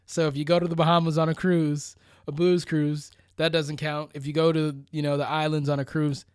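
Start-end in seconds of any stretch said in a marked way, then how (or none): none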